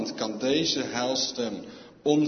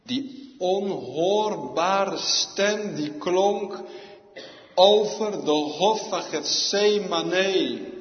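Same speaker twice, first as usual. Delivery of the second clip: slow, loud, much higher preacher's cry; preaching at full force; 8 semitones higher, 8 dB louder, much slower than usual. Acted. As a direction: let it ring out slowly over the congregation. (met volle kracht en langzaam gepredikt)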